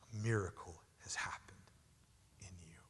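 soft unvoiced whisper. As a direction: hush it, intimate and awed. Hushed climax of the sermon.

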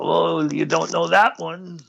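put-on slow, thick German accent; imitating a stern German teacher. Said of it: "he had this thick German accent".

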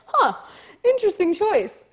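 high, loud mock intrigue; amused imitation of surprised casting team; bemused judgement. Imagines casting team's bemused reaction.

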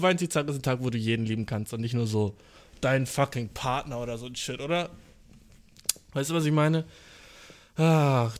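normal talking voice

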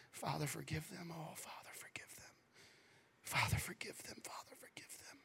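soft, fading to a whisper; whispered pleading prayer. Retold or imitated. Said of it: "Jesus would have said".

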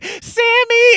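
very high, loud squeal of "Sammy"; mock shrill insistence. Sammy insisting on her nickname, squealed very high. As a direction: squeal the name like a spoiled rich girl.

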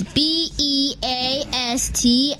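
slow, letter-by-letter spelling; deliberate spelled-out handle. Shown as a demonstration. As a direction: spell it out one letter at a time. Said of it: "It's Beastin Baller Zero".

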